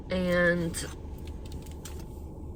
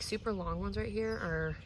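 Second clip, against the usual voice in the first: usual speaking voice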